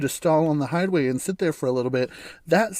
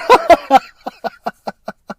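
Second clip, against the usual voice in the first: big high laugh bursting out; loud real laugh, much higher; loud burst of laughter; 11 semitones higher, 10 dB louder than usual. Real, not acted. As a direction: crack up at the Avatar line. Laughing at own joke.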